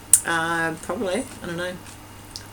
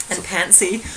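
normal talking voice

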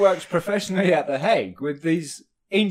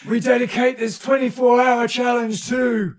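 mock announcer; 5 semitones higher, 3 dB louder than usual. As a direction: proclaim it like a big event host. Mock dedication of gaming challenge.

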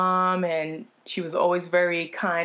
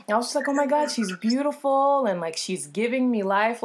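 gushing; 4 semitones higher, 4 dB louder than usual. Retold or imitated. Retelling gushing over dancer.

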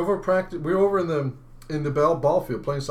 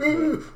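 gasp for air; 13 semitones higher than usual. Retold or imitated. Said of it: "and you're like".

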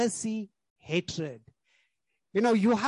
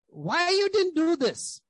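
higher and louder, an accusing demand; irritated scolding; 9 semitones higher, 3 dB louder than usual. Retold or imitated. Husband scolding his wife for undone chores, higher and louder. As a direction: demand it of your wife like she failed you.

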